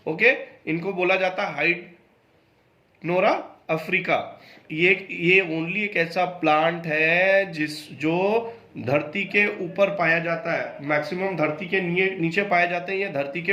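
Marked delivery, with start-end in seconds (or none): none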